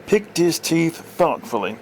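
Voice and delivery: plain reading voice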